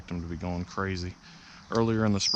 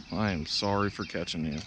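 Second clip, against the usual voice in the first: ordinary delivery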